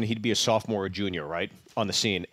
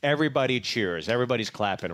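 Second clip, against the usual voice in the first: ordinary delivery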